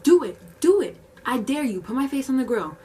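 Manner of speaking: loud, high-pitched taunting; goading dare. Retold or imitated. Worker daring customer over the grill, loud high taunt.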